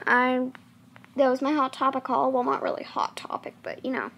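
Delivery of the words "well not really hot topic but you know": soft whispered confession. Real, not acted. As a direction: whisper it to the viewers. Confiding aside to viewers.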